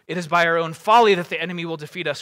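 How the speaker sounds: loud, heated accusation; Boromir's angry cry. Acted. Warrior blaming Frodo for coming defeat, loud cry. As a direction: cry out in reproach. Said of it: "cried Boromir".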